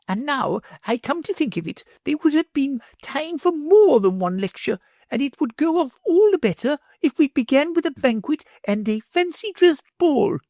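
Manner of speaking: high, grandiose noblewoman; grand lady voice. A narrator's reading. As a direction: plan the party grandly.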